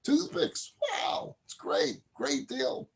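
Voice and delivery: very high-pitched, thrilled exclamations; excited exchange. Acted. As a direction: squeal with delight at the bargain. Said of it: "He's all excited".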